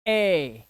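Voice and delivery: ए held out as "ay"; long ay sound. Shown as a demonstration. Teacher isolating the sound ए for learners, long ay. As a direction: sound out ए alone, long and falling. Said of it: "अब देखो sound देखना".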